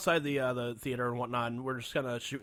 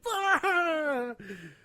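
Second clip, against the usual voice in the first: loud, high burst of mock hysterics; imitated helpless laughter; 14 semitones higher, 5 dB louder than usual. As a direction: imitate a cast cracking up mid-take. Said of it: "start fucking pissing their pants, like".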